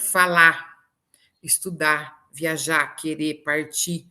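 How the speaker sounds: "falar, estudar" sem R final: falá, estudá; supressão do R. Shown as a demonstration. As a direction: corte o R final de "viajar", "querer", "partir". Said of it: "difícil quem fale rotineiramente utilizando esse R". ("querer, partir" ditos querê, partí)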